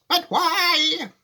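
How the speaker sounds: high, loud protest; shouted. A narrator's reading. Alarmed passengers shouting at Wonka, high and loud. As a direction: shout it in dismay. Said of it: "they all shouted at once".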